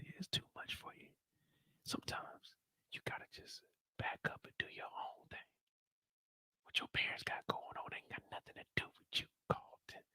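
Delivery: breathy mock whisper throughout; imitating a whisper. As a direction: whisper the advice. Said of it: "talking about".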